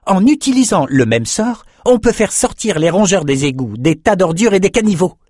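Peretta's voice. A narrator's reading. Peretta explaining the rat spell, high eager voice. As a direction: rattle off the plan excitedly.

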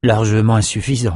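usual speaking voice

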